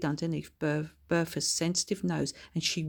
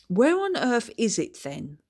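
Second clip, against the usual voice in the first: higher, louder refined lady; posh old lady voice; 5 semitones higher, 6 dB louder than usual. A narrator's reading. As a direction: speak like a grand old lady. Lady Ludroth character voice.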